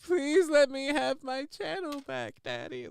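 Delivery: mock begging. Host imagining Chad begging for his channel, mock pleading. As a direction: plead like a grovelling child, hammed-up. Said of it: "I wish I had seen Chad begging".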